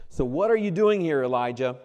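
high, loud searching question; God's challenge. Acted. God loudly asking Elijah why he is hiding. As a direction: call out to the runaway prophet.

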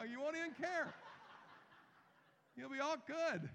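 cheerful laughing delivery. Real, not acted. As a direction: giggle through it, delighted. Laughing about carefree heaven.

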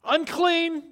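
high, loud cry of warning; screamed warning. Acted. Leper warning anyone who gets close, screamed out. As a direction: scream it out to keep people away. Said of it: "They had to scream out".

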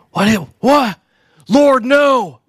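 mock panic. Patient panics at growling nurse.

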